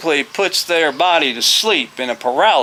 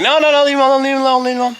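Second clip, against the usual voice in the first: high, louder frantic repetition; retold panicky plea to back off; urgent warding off; 13 semitones higher, 4 dB louder, much faster than usual. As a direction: call the kids off in a high, urgent voice. Retold warding kids off the bird.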